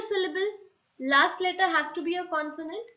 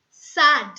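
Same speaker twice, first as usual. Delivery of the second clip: Sad called out loudly; loud example word; 13 dB louder than usual. Shown as a demonstration. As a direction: punch Sad like a board example. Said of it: "teacher reads example word".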